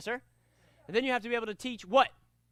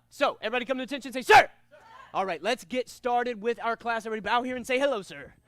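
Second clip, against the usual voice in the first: energetic class-opening call with a punched "Sir!"; rally the students to start class; lively, projected martial arts command; enthusiastic instructor; 7 dB louder, faster than usual. Shown as a demonstration.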